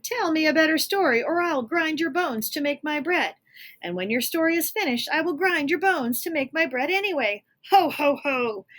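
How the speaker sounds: high, loud giant threat with laugh; threatening giant. Acted. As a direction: menace him like a giant.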